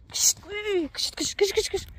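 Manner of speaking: high, sharp shoo calls at the hens; high calling hiss to scatter chickens. Real, not acted. Shooing chickens away.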